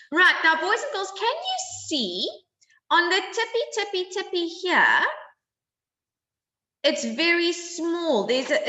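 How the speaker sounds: high, bright sing-song teacher voice; sweet, excited voice for little kids; kids show host. Acted. Teacher addressing class, high pitch.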